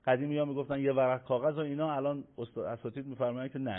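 ordinary talk

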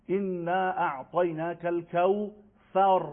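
slow, drawn-out "انا اعطیناک الکوثر" with th in الکوثر; careful Arabic "انا اعطیناک الکوثر", long vowels; Arabic pronunciation demo. Shown as a demonstration.

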